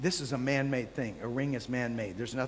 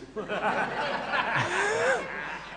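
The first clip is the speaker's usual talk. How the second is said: real high laugh; 11 semitones higher than usual. Real, not acted. Laughs at the stranger's cute phrasing.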